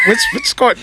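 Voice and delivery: high mock squeak. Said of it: "mock high voice".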